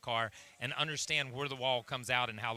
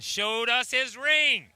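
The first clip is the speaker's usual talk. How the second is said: excited; 8 semitones higher, 8 dB louder, much slower than usual. Real, not acted. Champion walks up with ring.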